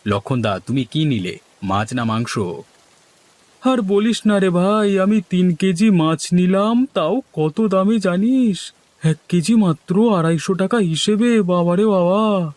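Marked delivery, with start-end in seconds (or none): 3.6-12.5 exasperated villager. Acted. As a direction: wail it a little, high and stretched. Exasperated villager complains of fish prices.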